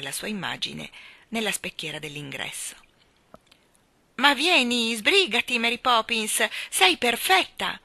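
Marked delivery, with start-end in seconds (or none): 4.2-7.8 loud, higher, urgent call; impatient. A narrator's reading. Michael urges Mary Poppins, loud and impatient. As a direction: hurry her along, bursting. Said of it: "esclamò Michael con impazienza".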